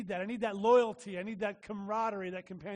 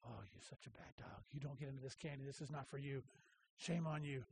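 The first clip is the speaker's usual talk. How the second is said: much lower, much softer mock scolding of a dog; mock mean talk; 9 semitones lower, 14 dB quieter than usual. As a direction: growl low and scold playfully. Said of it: "I'm just talking mean to her".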